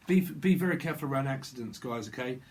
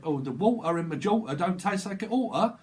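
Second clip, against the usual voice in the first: broad Cockney advert imitation, pitched up; mock London accent, higher than usual; 4 semitones higher, 4 dB louder than usual. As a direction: quote it in exaggerated Cockney with dropped t's. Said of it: "You're in London".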